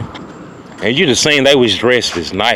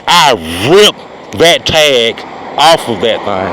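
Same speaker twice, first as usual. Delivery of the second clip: slow emphatic boast; slower than usual. Acted. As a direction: drag it out for the punchline. Punchline drawn out.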